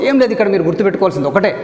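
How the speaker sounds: ordinary delivery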